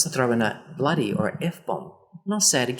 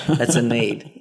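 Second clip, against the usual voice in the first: ordinary talk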